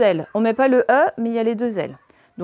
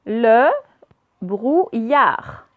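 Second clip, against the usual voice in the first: "Le brouillard" broken into Le, brou, illard; syllable by syllable; 6 dB louder, much slower than usual. Shown as a demonstration. Teacher reading words aloud to pupils, syllable by syllable. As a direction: sound out "Le brouillard" with clear gaps. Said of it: "on va faire les deux d'un coup".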